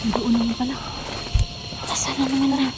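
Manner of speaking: hushed scared whisper. Real, not acted. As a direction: whisper it, afraid of being heard. Scared whisper in dark forest.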